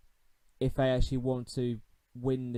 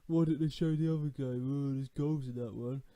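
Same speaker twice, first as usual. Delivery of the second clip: moaning fans; 3 semitones higher than usual. Retold or imitated. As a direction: mimic grumbling fans, half-hearted. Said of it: "everyone would just be moaning".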